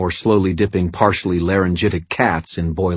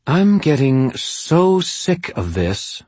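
higher, slow, weary female voice; fed-up woman; 9 semitones higher, slower than usual. Acted. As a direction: drag it out, sick of it all. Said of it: "Madeline said".

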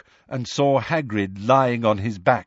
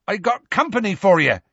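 higher, louder hearty call; shouted; 9 semitones higher, 4 dB louder than usual. A narrator's reading. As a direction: shout up cheerfully to the giant. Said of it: "Hagrid shouted".

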